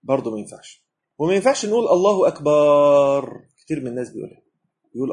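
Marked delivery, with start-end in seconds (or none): 1.9-3.4 "أكبر" drawn out with a long vowel after the b, slow; wrong long "أكبار". Shown as a demonstration. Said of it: "حطيت مد بعد الباء".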